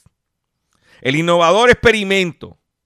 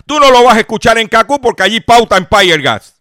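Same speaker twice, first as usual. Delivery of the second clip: loud; 4 semitones higher, 7 dB louder than usual. Real, not acted. Crítica a la competencia.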